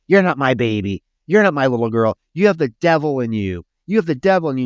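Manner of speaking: mock horrified mother pushing her away; rejecting mother. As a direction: throw the words at her like a frightened mother. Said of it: "the mother, Roger, is like".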